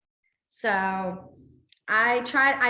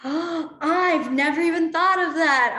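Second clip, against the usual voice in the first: amazed realization; 5 semitones higher, 5 dB louder, slower than usual. Real, not acted. Gasping reaction to side conversations.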